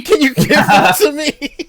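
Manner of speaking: high, loud mocking repeat of the ask; mocking whiny ask. Friend teasing him by replaying his ask, high mocking whine. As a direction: parrot him like a greedy kid. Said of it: "I just said it impulsively".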